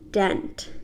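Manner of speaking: dent said as the plain English word; the mistaken dent, spelled-out style; how not to say dent; wrong pronunciation. Shown as a demonstration. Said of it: "You don't pronounce it like that".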